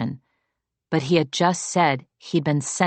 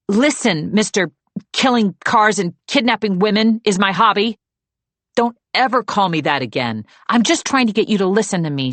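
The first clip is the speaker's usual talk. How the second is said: sharp, fast and louder, pushing him to listen; impatient; 6 semitones higher, 4 dB louder than usual. A narrator's reading. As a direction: cut him off, out of patience. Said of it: "she said impatiently".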